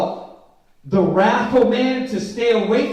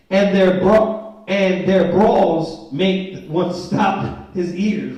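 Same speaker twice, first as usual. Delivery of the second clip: scripture reading; 5 dB louder, much slower than usual. Acted. Reads verse very slowly.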